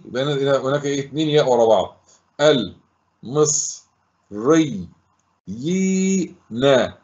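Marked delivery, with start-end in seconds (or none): 2.4-7.0 syllable by syllable. Shown as a demonstration. Teacher dividing the word for pupils, syllable by syllable. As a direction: sound out each piece with pauses between. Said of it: "نطقتها كل صوت مفرده".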